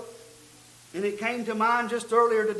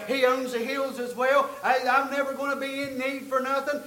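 louder, rising preacher's cadence; fervent sermon delivery; full-force preaching; 2 semitones higher, 4 dB louder than usual. Acted. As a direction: drive the point home to the congregation.

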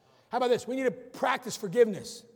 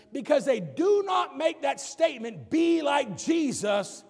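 slow, high, loud pulpit shout; preaching at full force; 6 semitones higher, 5 dB louder, slower than usual. Acted. Preacher warning the church about a lopsided Jesus, slow loud preaching. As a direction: hammer each word home slowly.

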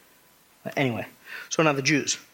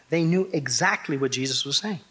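ordinary talk, nothing marked

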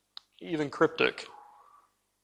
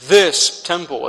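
"this" hit louder and slow in "this temple"; stress on this; 2 semitones higher, 6 dB louder, slower than usual. Shown as a demonstration. Preacher quoting the Pharisees, pressing the key word. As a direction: lean hard on "this", slowly. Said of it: "that's the emphasis of the text".

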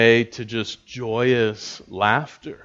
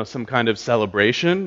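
normal talking voice

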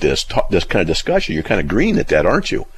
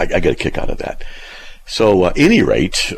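ordinary delivery

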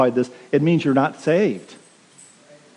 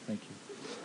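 whispered thanks; 5 semitones lower, 25 dB quieter than usual. Acted. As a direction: whisper "Thank you" under the breath. Acting out being forgiven.

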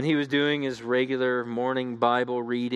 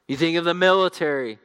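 raised, loud preacher's voice; forceful preaching; 7 semitones higher, 10 dB louder than usual. Real, not acted. Preacher warning the congregation about a divided unit, forceful preaching.

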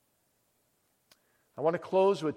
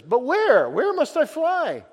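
much higher, frantic plea; desperate question; 11 semitones higher, 9 dB louder, slower than usual. Acted. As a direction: cry out lost and frightened.

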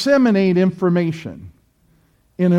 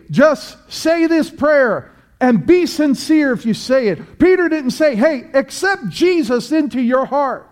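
rising, louder sermon voice; fiery preaching; 5 semitones higher, 4 dB louder than usual. Acted. Preacher exhorting congregation to repent, fiery preaching. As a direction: preach it hard to the pews.

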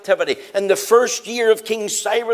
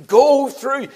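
"Go through" punched higher and louder, "Go" hit hard; emphatic call; 6 semitones higher, 5 dB louder than usual. Shown as a demonstration. Preacher driving home the doubled call to his listeners, emphatic. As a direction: ring out "Go through" like a summons. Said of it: "It's doubled for emphasis".

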